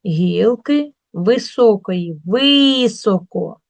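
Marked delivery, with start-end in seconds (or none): 2.3-3.6 "високо" with a long, loud first И; stress on И. Shown as a demonstration. Teacher showing the check word, stressed first syllable. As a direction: stretch ВИ in "високо" loudly. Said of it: "Під наголосом чуємо".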